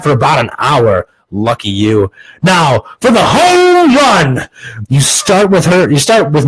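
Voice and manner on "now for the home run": high, drawn-out announcer shout; mock sportscaster fanfare; hyped announcement. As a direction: stretch it big and high for the finale. Announces the big finish.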